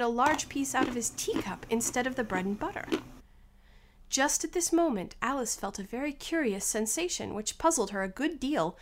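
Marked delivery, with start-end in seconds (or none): none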